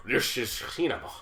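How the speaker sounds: slow, drawn-out auteur pronouncement; slow mock-grand accented declaration; Werner Herzog. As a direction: loosely imitate a solemn German director. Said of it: "Werner Herzog was been like".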